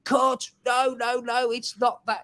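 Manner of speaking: high-pitched fussy correcting voice; fussy stickler producer. Retold or imitated. Producer halting the presenter's take to correct him, fussy impression. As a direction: imitate a pedantic director cutting in, pitch way up. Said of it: "he was sort of going".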